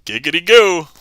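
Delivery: high, loud leering giggity; Quagmire impression. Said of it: "Family Guy and Quagmire".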